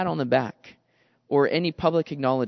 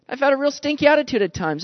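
ordinary talk

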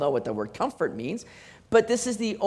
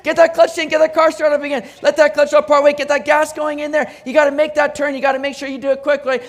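higher, much louder commanding coach; urgent instructions; 8 semitones higher, 8 dB louder, faster than usual. Retold or imitated. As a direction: bark out driving orders to a stalled learner. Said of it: "I'm sitting there saying".

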